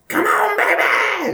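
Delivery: very high, strained throaty wrestler cry; over-the-top throaty caricature, stretched out; Juice Robinson. Acted. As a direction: screech it slowly, throat squeezed. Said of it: "what his real voice is".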